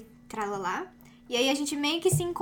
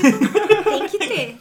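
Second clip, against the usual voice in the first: laughing; 6 semitones higher, 13 dB louder, much slower than usual. Real, not acted. Laughing insistence.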